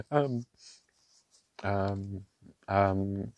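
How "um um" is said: usual speaking voice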